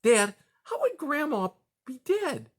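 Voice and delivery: put-on high child voice; little boy voice. A narrator's reading. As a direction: ask like a confused small child. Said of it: "interjected Spruce".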